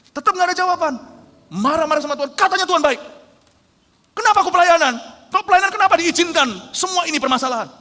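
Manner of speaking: loud, high furious rant at God; hammed-up tantrum of a frustrated servant; angry shouting. Acting an angry believer shouting.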